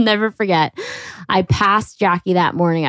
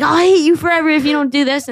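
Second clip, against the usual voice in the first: high, mock-angry ultimatum; hammed-up tantrum threat to mom; acted anger; 6 semitones higher, faster than usual. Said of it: "I'll do angry".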